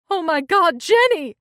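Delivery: high, loud cry of shock; horrified shout. A narrator's reading. Angela discovering Jenny on the floor, horrified shout. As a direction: scream it as you find her lying there.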